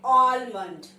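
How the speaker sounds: loud, low "almond" as AWL-mund; common misreading. Shown as a demonstration. Teacher showing how we read it, mispronounced with L. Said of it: "इसको हम पढ़ते कैसे हैं?".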